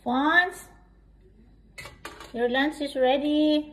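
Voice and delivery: calling out loud to another room; yelling across the room. Real, not acted. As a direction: call him to lunch from afar. Mom calls child to lunch.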